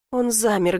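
ordinary delivery

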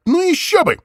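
high mocking sneer. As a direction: spit it out with scorn. Distorted caller sneers.

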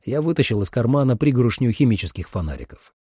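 ordinary delivery

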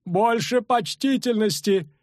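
high, slow, cracking matron's cry; brittle shout. A narrator's reading. Matron demanding respect from the bandit, high cracking cry. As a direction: cry out in a breaking old voice. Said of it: "крикнула ломким голосом".

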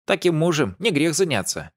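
put-on high, teasing old lady voice; playful old lady. A narrator's reading. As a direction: wink it like a cheeky old lady. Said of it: "подмигнула ей старушка". (лукавая старушка)

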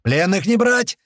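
high, loud little voice; squeaky shout. A narrator's reading. Skinny guy in glasses joins in, squeaking loudly. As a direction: squeak out a battle cry. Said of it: "пищит тощий очкарик".

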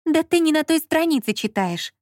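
high-pitched, impatient interruption; cutting him off. A narrator's reading. Lola interrupts Marquis's complaint, impatiently. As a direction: cut in with brisk exasperation. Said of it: "оборвала его Лола".

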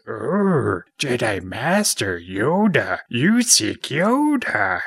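slow, swooping little creature voice; Yoda. Retold or imitated. Yoda answering Luke's search for a Jedi Master, slow creature voice. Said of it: "And he goes".

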